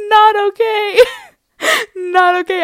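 high, helpless laughter through the words; can barely talk for laughing; real laughing. Real, not acted. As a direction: squeal it out while cracking up. Cracking up at own story.

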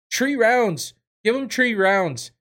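high, loud mock Irish shouting; mock Irish rant; horrible Conor impression. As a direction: ham up a bad Irish accent yelling at judges. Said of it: "that's a horrible impression".

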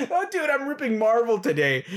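high, laughter-filled words; real laughing talk. Real, not acted. Fan admitting he's ripping Marvel, laughing. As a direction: talk while cracking up. Laughing at his own ranting.

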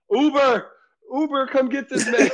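high, loud summons; mock calling out. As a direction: call out for a ride, mock-urgent. Retold guys calling for an Uber.

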